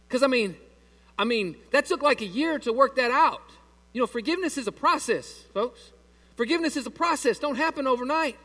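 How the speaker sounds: high, loud preaching at full force; fiery preaching. Acted. Pastor preaching to the church, loud and high.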